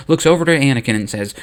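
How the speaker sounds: usual speaking voice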